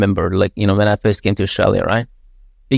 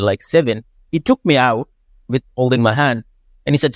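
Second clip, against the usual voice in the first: ordinary delivery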